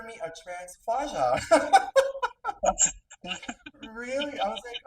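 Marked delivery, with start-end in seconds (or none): 1.4-3.8 high, loud burst of laughter. Real, not acted. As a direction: cackle at your own joke. Laughing at own joke.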